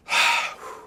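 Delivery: exhausted, voiceless sigh; weary sigh. Real, not acted. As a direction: let out a worn-out sigh. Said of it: "This is kind of, uh, exhausting".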